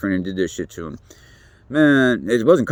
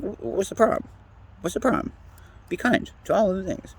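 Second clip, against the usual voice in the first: mocking high voice; 8 semitones higher, 6 dB quieter than usual. Mocking voice of the empathic side.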